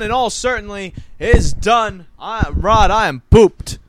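loud, drawn-out proclamation of being worn out; loud exhausted declaration. Real, not acted. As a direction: announce your exhaustion at full volume. Announcing he is worn out.

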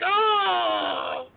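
high, drawn-out fangirl cry; mock swoon. Host imitating women watching the Italian judge, mock swoon. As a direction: squeal like a smitten fan.

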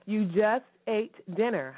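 ordinary talk, nothing marked

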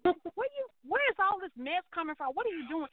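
retold indignation at playwright; exasperated, high-pitched challenge. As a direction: demand answers in disbelief. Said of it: "So I'm just like".

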